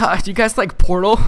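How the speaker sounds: mock eager kid. Kid interrupts friends.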